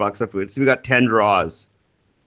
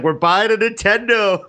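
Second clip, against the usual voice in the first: excited announcement; 8 semitones higher than usual. Retold or imitated. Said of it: "I was like".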